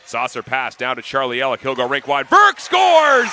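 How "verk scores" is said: higher, louder goal shout; goal call. Real, not acted. Goal call in hockey play-by-play.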